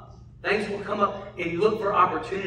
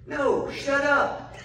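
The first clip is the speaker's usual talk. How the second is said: slow, higher, giggled-out protest; giggling girl; 10 semitones higher, much slower than usual. Retold or imitated. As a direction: laugh it off like a teasing little girl. Daughter laughing refusal.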